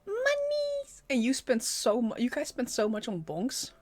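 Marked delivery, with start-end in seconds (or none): 0.1-1.0 squeaky echo. Retold or imitated. Echoes the high-pitched clip.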